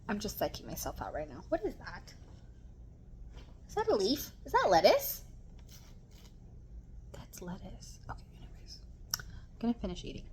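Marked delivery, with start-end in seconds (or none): 1.5-5.2 high, puzzled questions; suddenly notice something odd; surprised. Real, not acted. Spots something in her food. 7.1-8.0 whispered to herself; whispering. Real, not acted. Hushed to herself about food. 8.1-8.8 whispered okay, anyways; whispering. Real, not acted. Whispered, then said plainly later. 9.2-10.2 whispered to herself; whispering. Real, not acted. Whispered, quick aside.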